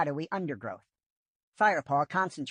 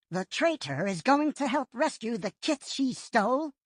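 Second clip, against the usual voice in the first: higher, thoughtful warrior voice; lightly voiced Whitestorm; 8 semitones higher than usual. A narrator's reading. Whitestorm understanding the leader's hint, lightly higher. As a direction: realize what the leader meant.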